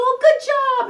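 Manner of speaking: put-on big cheerful praise for the child; enthusiastic praise. Shown as a demonstration. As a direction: praise a five-year-old to the sky. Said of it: "you go".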